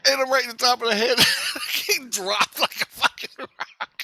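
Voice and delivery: real high-pitched cracking up; laughing while telling. Real, not acted. Storyteller recalls the rock landing, high helpless laughter. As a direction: squeal it out through laughter.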